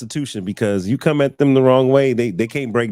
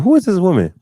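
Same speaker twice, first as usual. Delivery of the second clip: higher, louder, amazed repeat; mock amazement; 9 semitones higher, 5 dB louder, faster than usual. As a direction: exaggerate your astonishment. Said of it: "I was like".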